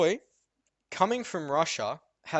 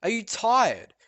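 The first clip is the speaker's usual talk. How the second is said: louder, higher accusing teacher voice; scolding teacher, hammed up; mock stern teacher; 8 semitones higher, 9 dB louder than usual. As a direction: imitate the teacher insisting, raised. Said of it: "And she's like".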